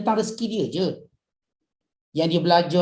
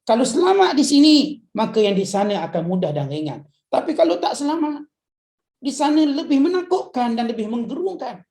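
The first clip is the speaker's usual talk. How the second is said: higher, louder preaching that builds on each clause; full-force preaching; 5 semitones higher, 4 dB louder than usual. Acted. Preacher warns the congregation about the grave, loud and rising. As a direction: warn the crowd with rising urgency.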